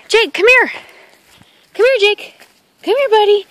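bright call to the dog. Real, not acted. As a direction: call him over from across the yard. Calling the dog over.

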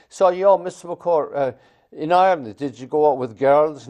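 high, loud, wheedling schoolkid questions; mocking student voice. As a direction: stall the teacher like a sly pupil. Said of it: "keep your".

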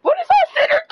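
a real high shout of disbelief; loud high outburst at the screen; exasperated shout. Real, not acted. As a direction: yell it up high at the useless center. Yelling at bad teammate.

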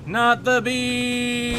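high, slow drawn-out wail of mock terror; hammed-up movie quote. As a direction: stretch it like a panicked film star. Movie quote about bees.

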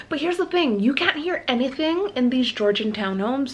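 ordinary delivery

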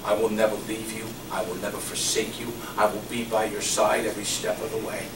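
soft, low reassuring promise; tender voice of Jesus. Retold or imitated. Preacher voicing Jesus' promise to believers, soft and low. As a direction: speak it gently like a comforting promise. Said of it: "He said".

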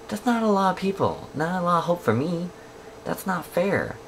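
whiny complaint. Real, not acted. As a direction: whine that it's unfair.

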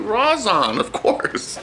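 ordinary talk, nothing marked

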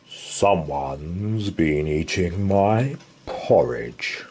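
low, slow growling bear; growling Father Bear. Acted. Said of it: "growled Father Bear".

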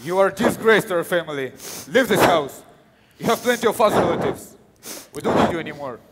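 furious father casting out his son; angry parent disowning. Acted. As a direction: throw the child out of the house.

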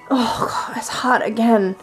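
normal talking voice

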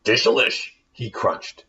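lively rhyming storybook reading; story narration. A narrator's reading. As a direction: read it like a bedtime tale for kids. Reads the rhyming tale aloud.

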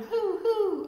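high, loud owl hoot; hollering owl. Acted. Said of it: "who hollered".

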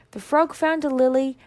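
ordinary delivery

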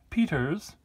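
high, loud call for the gardener; lady of the house. Pretend. Lady calling Peters in from the garden, mock imperious call. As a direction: put on a lady summoning her servant. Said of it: "Yes, my lady".

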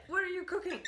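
high-pitched, nosy parrot voice. Acted. A parrot butting in on Marlene cooking, high and nosy. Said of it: "He's like".

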